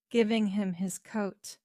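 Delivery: ordinary delivery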